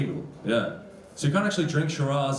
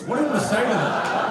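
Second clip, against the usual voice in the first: raised, high-pitched mild protest; exasperated; 10 semitones higher, 4 dB louder than usual. Acted. Comic asking the crowd about his boss, exasperated. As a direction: throw up a half-joking, helpless protest. Exasperated aside after being one-upped.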